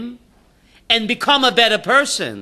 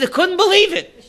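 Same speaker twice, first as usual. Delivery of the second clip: laughing disbelief; 9 semitones higher than usual. Retold or imitated. Retold disbelief, laughing shout.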